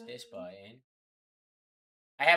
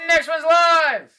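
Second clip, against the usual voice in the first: loud hype call; 11 semitones higher, 11 dB louder, slower than usual. Acted. Hyping the next break.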